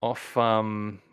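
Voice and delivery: normal talking voice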